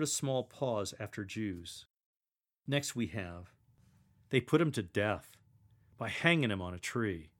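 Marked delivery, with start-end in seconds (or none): 4.3-7.3 dramatic reading. Shown as a demonstration. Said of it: "Speak the first sentence dramatically".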